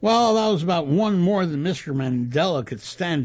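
ordinary delivery

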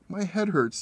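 put-on high, tearful child's complaint; mild sobbing. A narrator's reading. Boy telling his mother his head hurts, sobbing. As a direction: whimper it through tears. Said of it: "he said, sobbing".

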